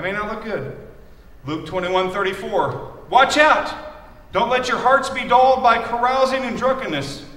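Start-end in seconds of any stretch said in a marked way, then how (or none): 3.1-7.2 forceful scripture reading. Acted. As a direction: read the verse out strong and loud.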